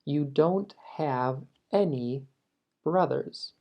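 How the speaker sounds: slow, careful teaching read of the English; slow model sentence. Shown as a demonstration. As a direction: spell it out slowly for the class. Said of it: "La versión plural". (lectura lenta y clara para alumnos)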